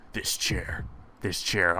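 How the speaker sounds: very soft, low whispered menace; obsessive whisper. Acted. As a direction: whisper it like a fixation. Acted obsession, dropping to whisper.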